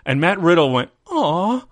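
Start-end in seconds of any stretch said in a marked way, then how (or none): 1.1-1.6 a high, hammed-up disappointed aw; mock sad aw. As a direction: whine it like a let-down buddy. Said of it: "he got real sad".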